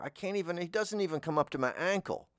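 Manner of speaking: normal talking voice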